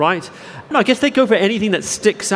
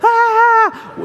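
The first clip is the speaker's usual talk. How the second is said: sharp high, loud fright yelp; retold yelp; 4 dB louder than usual. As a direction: squeal it like you just got ambushed. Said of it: "I yelped".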